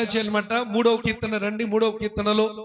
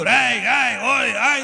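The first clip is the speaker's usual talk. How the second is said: mock yelling at home; slower than usual. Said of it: "అరుస్తుంది".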